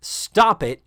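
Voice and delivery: a loud, high mock scream of stop it; hammed-up loud shriek. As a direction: shriek it like a fed-up housemother. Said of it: "screams in her beautiful dulcet shriek tone".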